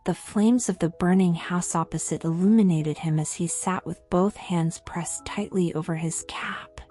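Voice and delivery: ordinary delivery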